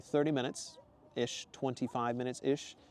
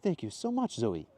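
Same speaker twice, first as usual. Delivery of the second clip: put-on Mateo's accent; slower than usual. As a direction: thank her in a put-on accent. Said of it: "Mateo said".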